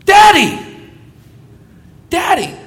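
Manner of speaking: put-on excited child calling his father; child calling daddy. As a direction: cry out for daddy like a five-year-old. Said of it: "What are they gonna say?".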